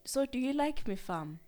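put-on kid brother voice. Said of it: "It was".

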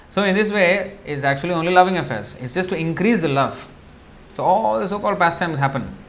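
ordinary talk, nothing marked

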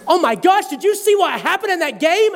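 suddenly thrilled about the game; mock excited. Distracted mid-fight, excited about game.

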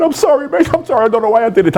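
high, whiny mock sobbing sorry; mock tearful apology. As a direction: plead like a crying child, hammed up. Gazza crying an apology.

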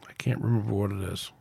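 near-whispered, low aside while searching; very soft, half-whispered self-talk; muttering to self. Real, not acted. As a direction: mumble it under your breath, trying to remember.

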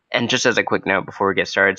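ordinary delivery

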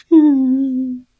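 high crying whimper. Acted. Whale cries sadly inside the monster's belly. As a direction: sob out one long high hum. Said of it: "伤心地哭了".

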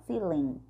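"feeling" ending on an N sound through a smile; quiet "feeling" with N, not M; smiled N ending. Shown as a demonstration. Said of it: "dá um sorrisinho".

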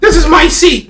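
high-pitched whiny tantrum, put on; mock tantrum. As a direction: imitate a grown man throwing a fit on a plane. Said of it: "grown men throwing tantrums are hilarious".

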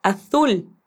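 "azul" with a lisped th, aθul; slow "azul", z said as th; Spanish from Spain: "azul" as aθul; Castilian z. Shown as a demonstration. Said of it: "Lo mismo pasa con la letra Z".

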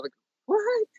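squeaky high disbelieving what; a sudden high-pitched double take; baffled. Real, not acted. Guest reacting to LinkedIn views, squeaky disbelief.

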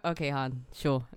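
low sarcastic "sure"; drawn-out doubtful drawl; sarcastic disbelief. Retold or imitated. Sceptical retort mocking interview answer.

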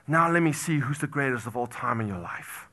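normal talking voice

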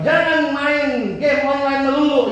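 hammed-up parental scolding. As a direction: stretch it out like a nagging mom.

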